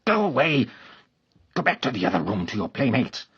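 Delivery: loud angry shout; enraged. A narrator's reading. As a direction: drive the boy off furiously. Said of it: "That enraged the man".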